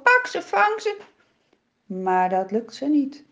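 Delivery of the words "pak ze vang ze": much higher frantic call; shouting. A narrator's reading. Villagers call out to catch the foxes, much higher and frantic. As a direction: villagers cry out to catch them. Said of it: "De mensen roepen en schreeuwen".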